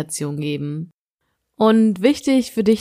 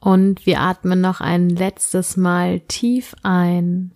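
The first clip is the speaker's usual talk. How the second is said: sleep meditation; slower than usual. Acted. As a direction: guide the listener gently into sleep.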